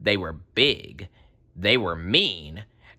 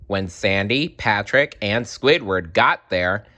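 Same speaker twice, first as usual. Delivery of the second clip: ordinary delivery, nothing marked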